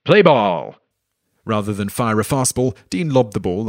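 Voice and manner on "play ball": loud, drawn-out ballpark call; umpire's yell. Acted. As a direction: yell it like an umpire starting play. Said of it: "umpire Billy Stewart yelled".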